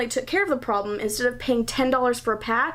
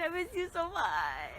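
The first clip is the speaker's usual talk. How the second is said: happy crying; 5 semitones higher, 12 dB quieter than usual. Real, not acted. Tearful reunion with her brother.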